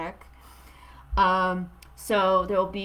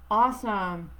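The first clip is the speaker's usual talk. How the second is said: ordinary talk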